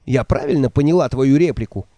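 usual speaking voice

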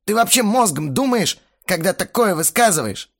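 high, loud indignant outburst; flaring up. A narrator's reading. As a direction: snap back in outrage. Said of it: "взвился Бельфигор". (вспылив, громко и возмущённо)

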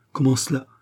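plain reading voice

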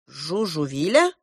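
"Жужувиля" as a rising question; asking back. A narrator's reading. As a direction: echo "Жужувиля" with the end swept up. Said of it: "переспросил Клоп".

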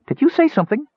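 a small creature asking lightly; high, wondering question; kender. Acted.

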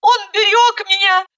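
high tearful lament; tearful despair. A narrator's reading. Bride despairing how to explain to Misha, high. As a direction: lament high through tears.

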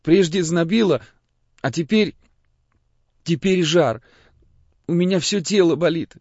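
put-on high, plaintive child voice; sick boy. A narrator's reading. Sick boy telling the priest what hurts, plaintive. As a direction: complain haltingly like a feverish little boy.

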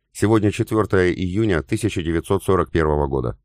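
usual speaking voice

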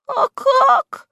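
very high, loud squeaky question; bewildered. A narrator's reading. Henchman asking boss how to do it, bewildered squeak. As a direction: squeak it in puzzlement. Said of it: "высказал недоумение вреднюга".

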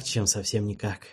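ordinary delivery, nothing marked

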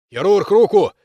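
shouted call for a hand; loud call. Acted. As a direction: shout for him to grab on. Calling a fighter for help.

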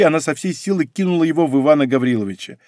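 plain reading voice

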